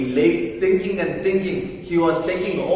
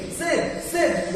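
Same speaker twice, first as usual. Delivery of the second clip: calling to wake; 8 semitones higher than usual. Acted. Tom shakes Sid awake.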